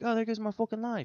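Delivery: high-pitched British bee; British accent. Acted. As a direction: put on a doomed little British voice. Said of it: "bees have a British accent".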